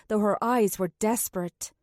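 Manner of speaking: ordinary delivery, nothing marked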